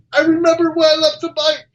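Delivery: spring the punchline as the rabbi. Acted.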